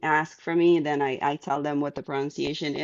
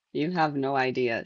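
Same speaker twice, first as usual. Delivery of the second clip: ordinary talk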